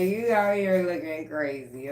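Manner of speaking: ordinary delivery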